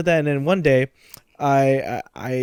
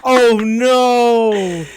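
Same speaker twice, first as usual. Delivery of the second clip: higher, drawn-out amused dismay; dismayed reaction; 6 semitones higher than usual. Real, not acted. Listener reacts to brother crying.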